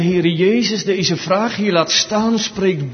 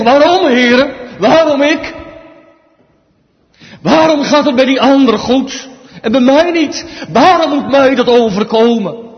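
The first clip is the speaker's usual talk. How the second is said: loud, higher, rebellious outcry at God; rebellious cry; 9 semitones higher, 10 dB louder than usual. Retold or imitated. Believer crying out in rebellion against God, loud rebellious cry. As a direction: hurl the why at heaven. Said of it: "uit een opstandig hart".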